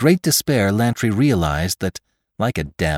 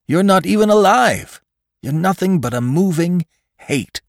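insistent argument climbing steadily higher; urgent McClure; 7 semitones higher, 3 dB louder than usual. Acted. Choked man tells his killer what he is, mounting urgency. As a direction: build from measured reasoning to high, emphatic urgency.

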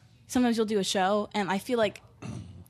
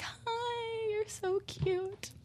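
put-on much higher, slow, sweet coo to a little kid; cutesy apology; 13 semitones higher, 10 dB quieter, much slower than usual. Singer apologizing to a young fan after the show, high sweet coo. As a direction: coo it softly like talking to a toddler.

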